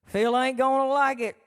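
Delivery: loud, hyped-up impression of Uncle Si; loud excited Si. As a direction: belt it out like an excited old uncle. Si excitedly on the phone.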